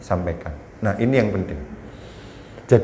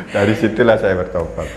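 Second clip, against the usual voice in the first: laughing. Real, not acted. Laughs at own confession.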